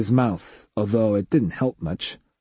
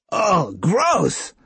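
disgusted holler; 8 semitones higher than usual. A narrator's reading. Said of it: "Tanji hollered".

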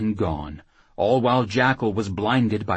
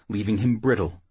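usual speaking voice

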